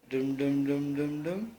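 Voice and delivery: steady rhythmic drum-like dums; bouncy string of nonsense syllables; playful "dum dum" noises. Pretend. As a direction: amuse a baby with drum sounds.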